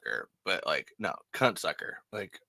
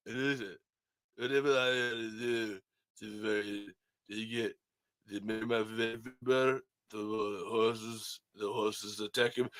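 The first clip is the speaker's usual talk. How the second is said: Stallone impression; 2 semitones lower, 7 dB quieter than usual. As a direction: do Sylvester Stallone confessing to the hosts. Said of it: "I was trying to do Stallone".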